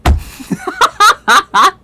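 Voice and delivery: loud, high burst of laughter; big laugh. Real, not acted. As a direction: explode into real laughter. Laughs hard at article.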